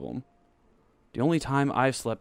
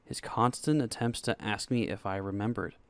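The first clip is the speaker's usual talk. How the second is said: plain reading voice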